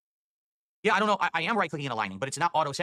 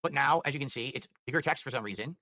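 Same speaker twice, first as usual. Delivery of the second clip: ordinary talk, nothing marked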